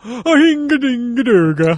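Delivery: slow, high Swedish chef gibberish; sing-song mock Swedish chef accent. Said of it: "The Swedish chef".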